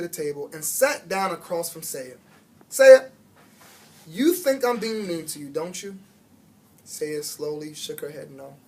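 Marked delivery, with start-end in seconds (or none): 2.7-6.0 gentle challenge. A narrator's reading. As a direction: call her name, then probe. Teacher confronting quiet pupil.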